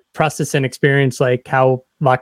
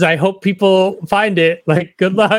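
higher, put-on sarcastic voice; mock sendoff; 6 semitones higher than usual. Interviewer mocking releasing a record into the void, higher sarcastic voice. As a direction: toss it off like a hopeless well-wisher. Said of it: "And it's just like".

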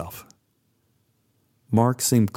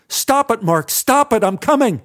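scared call; 10 semitones higher, 3 dB louder than usual. Acted. Frightened reply to pounding.

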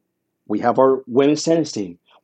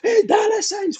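much higher, racing touchdown call in Spanish; excited Spanish play-by-play call. Retold or imitated. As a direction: shout the call as the runner breaks away. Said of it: "it had to be this call".

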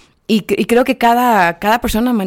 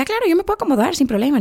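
eager agreeable voice; 8 semitones higher, faster than usual. Retold or imitated. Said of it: "la Chelsea como que".

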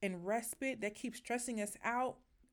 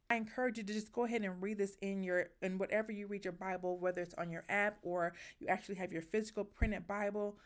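ordinary talk

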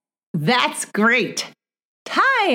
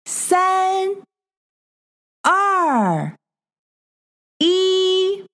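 high sing-song counting; 9 semitones higher, much slower than usual. Acted. Counting down for child learner.

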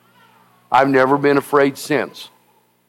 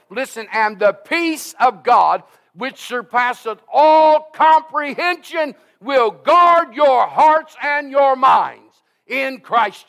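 full-force preaching; 9 semitones higher than usual. Acted. Preacher urging the congregation to trust God, ringing preaching.

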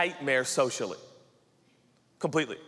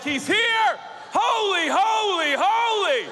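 much higher, louder rapturous cry; ecstatic shout; 6 dB louder, slower than usual. Retold or imitated. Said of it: "I was like".

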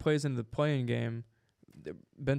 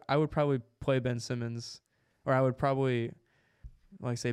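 ordinary talk, nothing marked

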